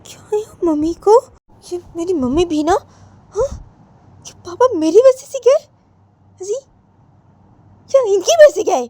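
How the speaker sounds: high-pitched, incredulous complaint; Poonam, indignant grumbling. Acted. Daughter alone complaining about the chores mummy left, indignant. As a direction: grumble in disbelief, pitched well up.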